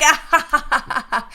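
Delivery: high, loud mock cackle; maniacal villain laugh. Hijacker gloats at her victims, maniacal laughing. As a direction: burst into a hammed-up evil laugh. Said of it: "she just starts laughing maniacally".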